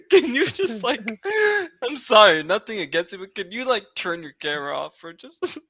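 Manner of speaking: high, giggly apologetic request; laughing plea. Real, not acted. Shy guest asks friend to kill camera, laughing. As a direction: plead through helpless laughter.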